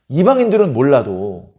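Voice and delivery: normal talking voice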